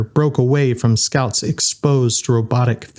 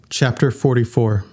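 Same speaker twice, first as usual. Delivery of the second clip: usual speaking voice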